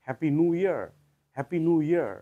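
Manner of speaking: হ্যাপি নিউ ইয়ার with noo and a sounded r; American-accent happy new year. Shown as a demonstration. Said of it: "যদি আমেরিকানদের মতো বলি". (American উচ্চারণে হ্যাপি নিউ ইয়ার)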